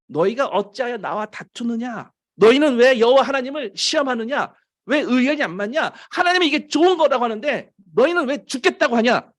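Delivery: high-pitched, insistent scolding questions; acted rebuke. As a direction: press each question harder, challenging the complainers. Acted rebuke of the complaining people.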